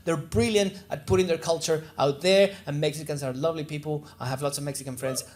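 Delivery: ordinary talk, nothing marked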